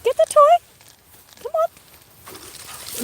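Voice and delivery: very high, slow "get the toy"; drawn-out dog call. Real, not acted. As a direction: stretch it out high to lure the dog. Urging the dog to fetch.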